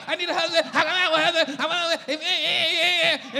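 high, loud, whiny complaining; mock whining complainer. Preacher mocking complaining church members before God, high and loud. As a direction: ham up a griping believer.

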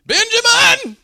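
high, loud wailed call, imitating a weeping great-uncle; mock crying. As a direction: cry out the name in hammed-up tears. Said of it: "And he's crying".

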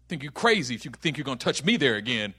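low, menacing dare; tough attitude. Acted. Guarding the old wound, thug-like defiance. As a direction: dare them under your breath. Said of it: "that whole attitude".

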